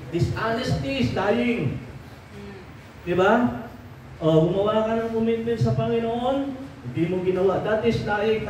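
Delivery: a pastor's rising sermon rebuke; fiery preaching. Acted. Pastor scolding congregation over broken promises to God, fiery preaching. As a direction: preach it high and forceful, rebuking the flock.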